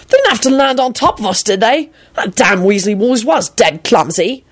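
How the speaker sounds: high, indignant grumble. A narrator's reading. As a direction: complain after being startled. Startled man complains of arrivals.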